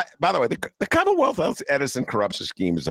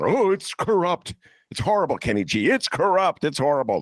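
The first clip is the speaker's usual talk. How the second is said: mock Rauner outrage; 8 semitones higher than usual. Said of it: "I remember Ron".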